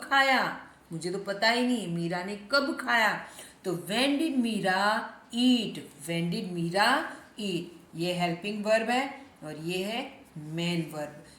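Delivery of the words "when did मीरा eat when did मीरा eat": very slow, word by word; slow dictation-style read; slow model sentence. Shown as a demonstration. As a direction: spell it out slowly for learners. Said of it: "teacher models English sentence slowly".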